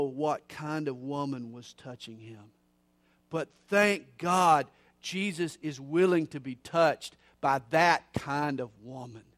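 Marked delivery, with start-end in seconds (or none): 3.3-9.2 loud, rising revival preaching; preaching at full force. Acted. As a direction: build it up like a pulpit climax.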